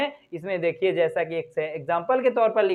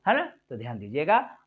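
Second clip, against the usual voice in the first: normal talking voice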